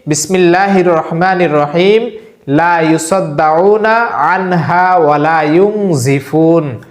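slow, measured Arabic recitation; Quranic recitation. Shown as a demonstration. Imam teaching viewers a verse, slow recitation. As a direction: chant the verse slowly for learners to follow. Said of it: "আমি বলছি".

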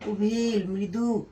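Oval মৃদু drawn out slowly and gently; slow and mild. Shown as a demonstration. Teacher demonstrating mild ovale to students, slow and gentle. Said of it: "জোরে উচ্চারণ হয় না".

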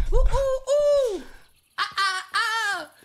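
loud, high drawn-out whoops; high whooping. Pretend. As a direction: hoot high and loud, playfully.

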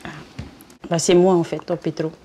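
ordinary delivery

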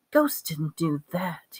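slow, softened delivery; said slowly. A narrator's reading. Harry doubting ghosts tore the chair, said slowly. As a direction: draw it out quietly, weighing each word. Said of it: "he said slowly".

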